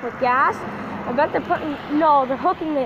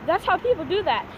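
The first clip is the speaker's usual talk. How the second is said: usual speaking voice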